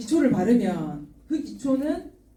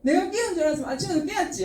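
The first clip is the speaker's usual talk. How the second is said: quick, higher-pitched grumbling comeback; mock maternal complaint; imitating indignant mother; 6 semitones higher, faster than usual. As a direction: protest fast and high, like an aggrieved mum. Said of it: "이렇게 얘기를 하시는 거예요".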